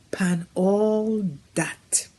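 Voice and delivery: breathy hushed whisper, slow. Acted. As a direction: lean in and whisper it slowly to the congregation. Preacher drops to a hush.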